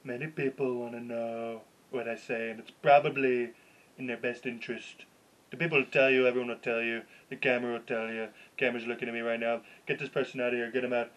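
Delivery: lightly voiced Trump, low and soft; a mild take on Trump's rally cadence; understated Trump rally speech; Donald Trump impression. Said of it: "Could you do that Trump impression?".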